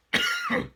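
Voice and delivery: sudden harsh cough; loud cough. Real, not acted. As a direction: cough hard, caught off guard. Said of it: "sorry about that".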